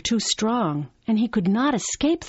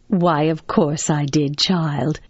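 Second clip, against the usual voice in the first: slow, assured motherly reply, pitched down; mother's voice; 4 semitones lower, 4 dB louder, slower than usual. Acted. Mother answering her child about Peter Pan, assured. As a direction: answer slowly and surely, dropping on the last word. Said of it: "she will say".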